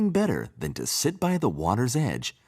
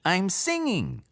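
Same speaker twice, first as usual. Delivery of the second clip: tiny frog; 11 semitones higher than usual. A narrator's reading. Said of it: "said the tiny frog".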